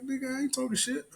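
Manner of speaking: very high, softened mock protest; protesting denial. Cashier answering a homeless man's accusation, protesting. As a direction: squeak out an incredulous denial.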